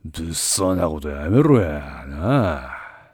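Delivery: yakuza menacing drawl. Acted. Togo warns Jiro off.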